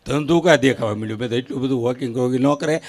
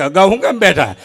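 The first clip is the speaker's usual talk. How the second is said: surprised question; 7 semitones higher, 7 dB louder than usual. Retold or imitated. Officer's puzzled question.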